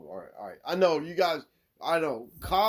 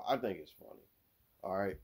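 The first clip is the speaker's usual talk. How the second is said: ordinary talk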